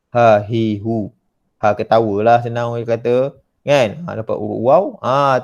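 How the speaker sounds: usual speaking voice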